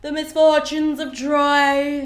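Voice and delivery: slow, mock-tragic sigh of a line; over-the-top tragic hero, slow and loud; dramatic lament. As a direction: ham up the hero's woe, drawn out. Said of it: "a little dramatic moment".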